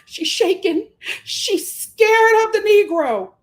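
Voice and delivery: high, hammed-up fake concern; mock the host with a shrill pretend panic; high mocking impression of the host. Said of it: "The host was like".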